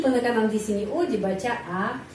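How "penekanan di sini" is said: ordinary talk, nothing marked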